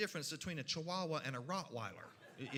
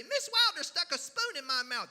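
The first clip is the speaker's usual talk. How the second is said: put-on higher, louder child's complaint; tattling child; 8 semitones higher, 6 dB louder than usual. Child telling mama about the teacher, high loud tattling. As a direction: whine it out to mama as a schoolkid. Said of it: "And you say".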